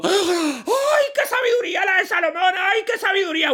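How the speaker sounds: high shouted crowd in awe. Acted. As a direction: cry out high in admiration. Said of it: "Y todo el mundo".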